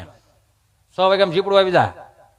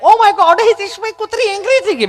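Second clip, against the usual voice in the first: high, loud squeal of alarm; mock panic; 13 semitones higher, 4 dB louder than usual. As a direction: report danger like a panicking city visitor. Frightened visitor runs up to him.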